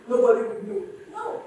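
ordinary delivery

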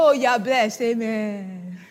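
low, offhand blessing with a drawn-out amen; mock unserious blessing. Preacher imitating people who are not serious, offhand. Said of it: "at times people are not serious".

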